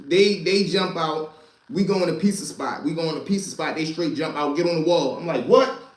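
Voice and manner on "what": startled high yelp of a word; shocked what. Retold or imitated. Narrator startled as cops order him against the wall, loud surprised yelp. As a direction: caught off guard, loud and high.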